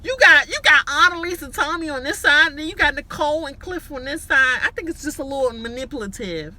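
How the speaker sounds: high, loud hyped-up rant; fired up, preaching the game; excited. Real, not acted.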